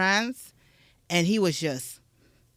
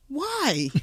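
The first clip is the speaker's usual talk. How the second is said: ordinary delivery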